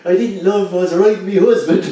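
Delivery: high mock reply; lightly hammed-up woman's voice; gossiping factory woman. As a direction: pitch it up like a cheeky coworker.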